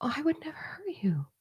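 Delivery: imitating a soft hurt whispered plea; mock pleading whisper. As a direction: whisper it like a wounded apology. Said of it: "reacted in a".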